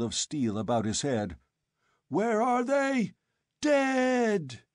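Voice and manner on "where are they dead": furious howl. A narrator's reading. Old chief howling on.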